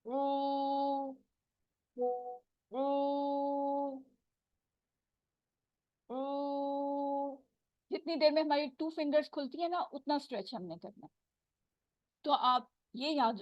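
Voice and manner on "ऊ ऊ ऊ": ऊ held long for two counts, soft and even; stretched oo. Shown as a demonstration. Teacher shows students the two-count stretch of ऊ. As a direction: draw the ऊ out evenly for two counts. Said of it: "टू count stretch करें तो ये ऐसे होगा".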